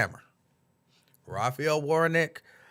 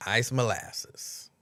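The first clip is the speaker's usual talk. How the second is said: very slow, drawn-out; savoring; 3 semitones lower, much slower than usual. Real, not acted. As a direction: roll the name around fondly. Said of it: "savoring cookie name".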